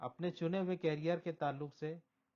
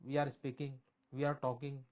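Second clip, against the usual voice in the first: ordinary talk, nothing marked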